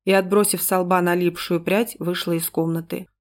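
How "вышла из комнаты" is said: usual speaking voice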